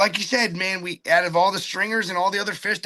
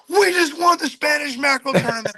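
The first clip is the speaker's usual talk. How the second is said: high-pitched, excited shout; retold victory scream; 10 semitones higher, 4 dB louder than usual. Angler tells how he cheered the big catch, excited scream. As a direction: yell it in triumph, pitched way up.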